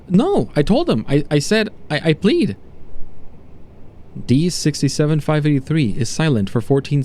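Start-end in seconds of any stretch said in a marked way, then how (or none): 0.1-2.5 higher, stammering protest; distressed denial. Acted. Accused subject to doctor denying guilt, distressed. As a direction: start high and falter into stammered pleading.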